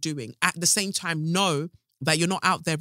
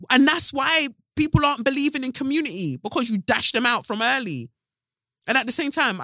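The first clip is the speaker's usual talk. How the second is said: heated; 8 semitones higher, 4 dB louder than usual. Real, not acted. Heated rise.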